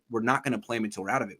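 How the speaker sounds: usual speaking voice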